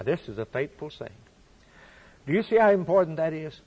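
normal talking voice

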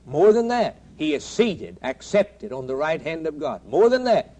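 thundering revival preaching; preaching at full force. Acted. Climbing through the four challenges.

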